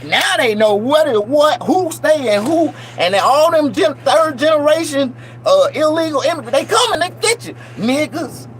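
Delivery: mock warning. Taunting sing-song warning.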